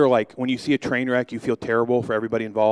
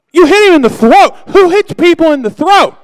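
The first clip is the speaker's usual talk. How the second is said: outraged yell; 15 dB louder than usual. Retold or imitated. Outraged at friend's throat punch.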